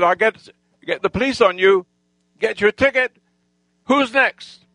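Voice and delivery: high, rising, emphatic challenge; taking on all comers; re-enacted threat climbing in pitch; challenging threat. Retold threat to the whole gang.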